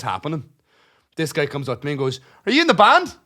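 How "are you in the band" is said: high, loud mock question; mock eager question. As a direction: ape the guy's pushy high asking. Retold stranger asking at door.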